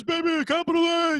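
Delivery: high, loud basketball announcer yell; Dick Vitale. Retold or imitated. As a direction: imitate an over-excited sportscaster hollering. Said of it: "he would talk like this".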